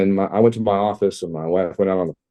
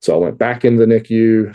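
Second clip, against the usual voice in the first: normal talking voice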